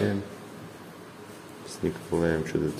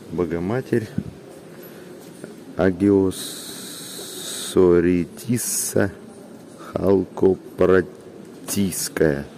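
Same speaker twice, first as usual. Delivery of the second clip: syllable by syllable, very slow; very slow careful reading; much slower than usual. Real, not acted. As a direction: sound out the unfamiliar names slowly. Slowly sounding out icon names.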